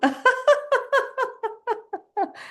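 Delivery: very high laughing peals; laughter switched on. Shown as a demonstration. Turning the sound back on, high laughter. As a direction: burst suddenly into long high laughter. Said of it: "Then turn it back on".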